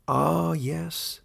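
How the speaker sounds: lightly voiced old warden, genial; a genial old ruler opening the meeting. Warden addressing his animal council, lightly voiced and genial.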